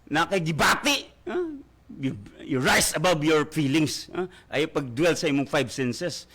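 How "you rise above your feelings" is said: usual speaking voice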